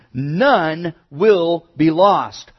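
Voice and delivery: usual speaking voice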